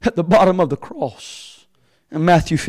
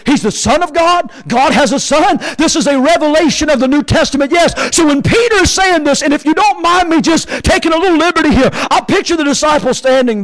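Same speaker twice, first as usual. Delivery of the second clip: high, driving preacher's voice; full-force preaching; 8 semitones higher, 4 dB louder than usual. Acted. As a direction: press the revelation home, loud and urgent.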